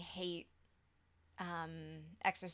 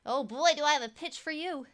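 higher, louder, eager pitchman; eager sales pitch; 6 semitones higher, 8 dB louder than usual. Acted. Kid pitching a creepy idea to a director, eager. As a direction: sell it with showy enthusiasm.

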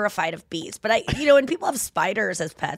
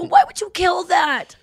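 upset outburst; 9 semitones higher, 5 dB louder than usual. Retold or imitated. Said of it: "I was so mad".

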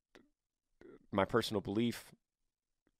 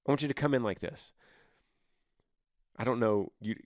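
ordinary delivery

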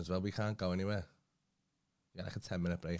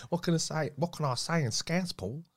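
higher-pitched rubbish Scouse; bad Scouse accent; 6 semitones higher than usual. Retelling a friend's attempt, bad Scouse accent. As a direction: put on a poor Scouse accent. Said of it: "his Scouse accent was bin".

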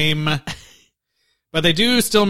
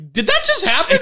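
disbelief; 13 semitones higher than usual. Retold or imitated. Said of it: "I was like".

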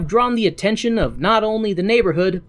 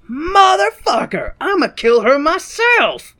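slow, much higher mock rage; hammed-up angry cop vowing revenge; furious lieutenant; 11 semitones higher, 4 dB louder than usual. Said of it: "he's like".

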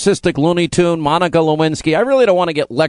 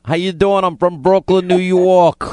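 put-on Brooklyn accent, lively and playful; 3 semitones higher than usual. Host playing along with a Brooklyn caller, in a Brooklyn accent. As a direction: lay on a thick mock Brooklyn accent. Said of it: "With a Brooklyn accent?".

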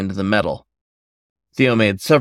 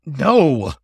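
sharp, panicked cry; 6 semitones higher, 3 dB louder than usual. A narrator's reading. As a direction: blurt it out in alarm. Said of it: "his voice close to panic".

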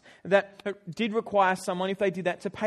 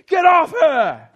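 high, loud yell to stop a stranger; shouted; 8 semitones higher, 15 dB louder, much slower than usual. Retold or imitated. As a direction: bellow it at the top of your voice to stop him. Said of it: "I yelled at the top of my voice".

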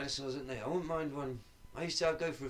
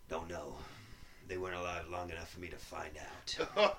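grim soldier voice; 7 semitones lower than usual. Retold or imitated. Soldier's grim answer, low voice.

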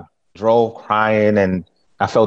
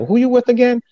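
much higher, quizzical question; puzzled client; 13 semitones higher than usual. Retold or imitated. Client who forgot his company, asking puzzled. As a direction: play a confused client asking again.

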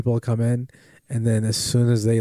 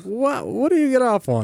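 indignant; 13 semitones higher than usual. Retold or imitated. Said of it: "I was like".

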